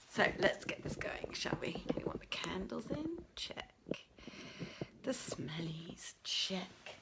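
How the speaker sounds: whispering to herself while ticking things off; soft unvoiced muttering, item by item; hushed self-talk while setting up; whispered checklist. Real, not acted.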